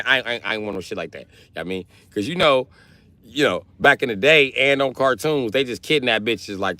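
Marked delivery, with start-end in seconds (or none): none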